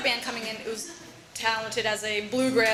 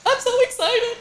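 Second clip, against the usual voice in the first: put-on high, louder childlike glee; little kid; 12 semitones higher, 5 dB louder than usual. Daughter mock-gushing like an excited kid, much higher. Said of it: "I was a little kid".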